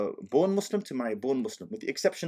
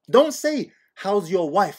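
mock scolding; 7 semitones higher, 8 dB louder, slower than usual.

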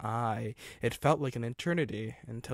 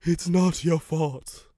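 slow, halting, raised voice; gasping; 5 semitones higher, 6 dB louder, slower than usual. A narrator's reading. Wrecked engine consoling Stephen, slow gasping delivery. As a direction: labour over each word as if hurt. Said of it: "it gasped".

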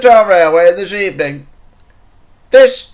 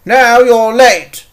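stern reprimand; 5 semitones higher, 5 dB louder than usual. Acted. Controller orders and reprimands engine.

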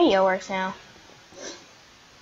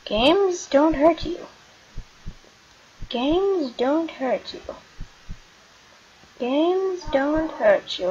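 self-soothing mantra; 5 semitones higher, slower than usual. Real, not acted. Calming self in scary game.

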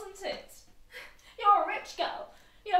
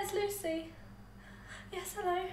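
whispering on the phone; 3 semitones lower, 7 dB quieter than usual. Acted. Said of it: "whispering on the phone".

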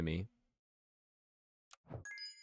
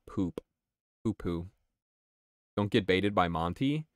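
ordinary talk, nothing marked

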